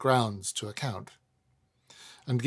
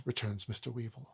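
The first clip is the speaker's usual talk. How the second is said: ordinary delivery, nothing marked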